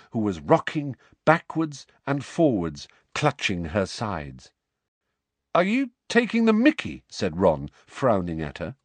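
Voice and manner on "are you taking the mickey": indignant. Acted. Ron frowning at Luna.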